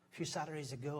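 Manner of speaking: ordinary delivery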